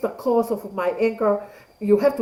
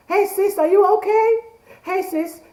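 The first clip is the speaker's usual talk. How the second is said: 1 playful sister greeting; 11 semitones higher than usual. Pretend. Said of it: "Make a comment".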